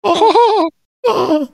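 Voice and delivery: teasing falsetto oohs; very high, playful falsetto; mock high voice. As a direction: pipe up comically high. Playing along with high voice joke.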